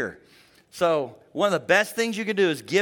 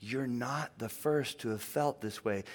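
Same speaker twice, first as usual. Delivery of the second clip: gentle reassurance; 5 semitones lower, 9 dB quieter than usual. Retold or imitated. Pastor telling a guilty widow her feelings are normal, lower and softer. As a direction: lower and softer, permission given. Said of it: "I said".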